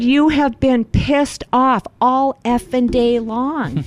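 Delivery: high, slow, drawn-out mock accusation; imitated outburst, stretching out each word; retold angry accusation. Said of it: "now all of a sudden you're really angry".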